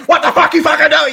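an angry dad bursting in, yelling at his son. Retold or imitated. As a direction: scream at your son, half laughing. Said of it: "fucking skitching at me".

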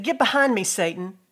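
loud, commanding rebuke of Peter; stern rebuke. Acted. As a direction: thunder it at him like Jesus.

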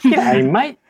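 loud, high, hammed-up Australian "G'day, mate"; overdone Australian. Disguised bugbear practicing an Aussie greeting, overdone Australian. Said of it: "Is it twangy enough?".

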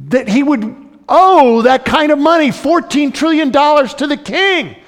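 high, loud scoffing with a laugh; mocking disbelief. Crowd scoffing at the debtor in loud mocking disbelief. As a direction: sneer at the fool like a baffled crowd. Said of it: "scratching their heads and saying".

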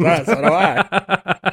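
put-on slow, laughing old-timey drawl; a light old-timey talker, laughing. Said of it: "we got old-timey voice".

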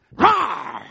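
short, high, loud roar; lion roar. Shown as a demonstration. Preacher playing the old lion for church, sudden roar. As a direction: burst out with a sudden lion's roar.

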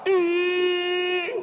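high wailing sob, imitating the brother; mock the brother's sudden cry; imitated wail. Pastor imitates the crying brother's high wail. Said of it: "he started crying".

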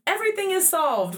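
high-pitched excited child's proclamation, retold; triumphant announcement. Daughter reenacts showing mom the found bill, mock triumphant. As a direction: announce it like a kid who has solved everything.

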